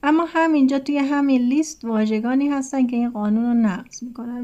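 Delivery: ordinary talk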